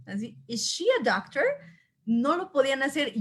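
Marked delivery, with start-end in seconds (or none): 0.5-1.4 "Is she a doctor?" with a crisp English sh in "she"; clear English question. Shown as a demonstration. Teacher showing Spanish speakers how to say "Is she a doctor?" in crisp English. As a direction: model the English "she" sound carefully. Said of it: "no podían generar ese sonido".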